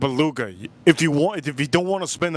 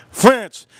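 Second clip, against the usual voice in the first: louder, higher burst on French; loud exclamation; 7 semitones higher, 9 dB louder than usual. Pretend. Streamer exclaims about France, loud high burst. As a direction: announce it with a bang.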